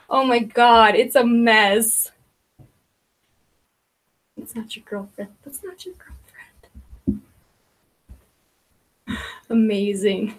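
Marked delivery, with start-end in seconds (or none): 5.4-7.2 whispered higher, slow and secretive; whispered aside. Retold or imitated. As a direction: hiss it like a scandalous secret. Repeats it as a whisper.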